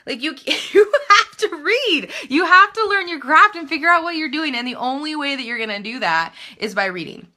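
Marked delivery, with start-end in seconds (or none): 0.1-4.5 laughing exasperation. Real, not acted. Scolding non-reading would-be writers.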